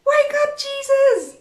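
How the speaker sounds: high, urgent panicked call; urgent wake-up call. Acted. Frightened friends rousing sleeping Jesus, high urgent cry. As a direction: shake him awake with your voice.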